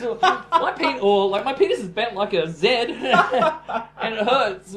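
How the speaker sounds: high, loud, laughing disbelief, hammed up; incredulous outburst. Mocking a TV patient to her co-host, loud laughing disbelief. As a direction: act out the shock of waiting eight years, laughing through it.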